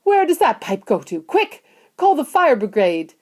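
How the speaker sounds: high, urgent demands; panicked yelling. A narrator's reading. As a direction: yell for urgent help. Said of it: "yelled Missus Gloop".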